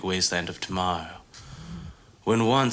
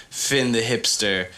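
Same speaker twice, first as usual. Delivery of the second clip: usual speaking voice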